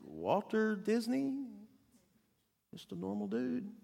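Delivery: put-on small, soft, high little-girl voice; puzzled child imitation, slow and tentative; Diane. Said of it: "she looked at the class and goes".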